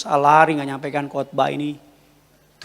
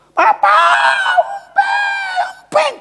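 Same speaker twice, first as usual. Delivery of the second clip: very high screamed wail; imitated toddler scream; 4 dB louder, much slower than usual. Toddler crying to papa for his pacifier, imitated screaming wail. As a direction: shriek like a crying toddler demanding. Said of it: "dia nangis, dia teriak".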